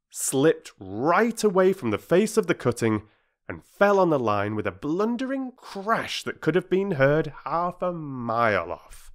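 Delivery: plain reading voice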